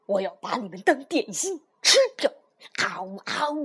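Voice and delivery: low, menacing turtle threat; vicious snapping turtle. A narrator's reading. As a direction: threaten to gobble them up. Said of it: "鳄龟恶狠狠地说".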